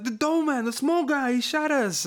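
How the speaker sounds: high-pitched, mock-frantic insisting; hammed-up desperate explaining to the boss; exasperated gang member.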